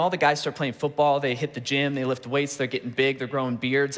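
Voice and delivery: normal talking voice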